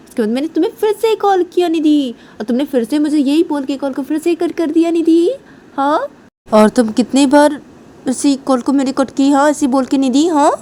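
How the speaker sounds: acted indignant complaining; annoyed complaint. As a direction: scold a partner with mounting grievance. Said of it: "मैं तुम्हारे पर बहुत नाराज़ हूँ".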